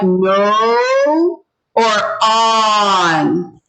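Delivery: "no" held long, then "on" offered against it; vowel contrast. Shown as a demonstration. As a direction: stretch the vowels in "no or on". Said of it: "does this O sound more like".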